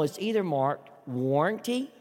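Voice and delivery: usual speaking voice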